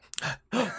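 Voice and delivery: a big high gasp of fake shock; hammed-up shocked gasp; mock gasp. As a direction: gasp as if hearing a spoiler.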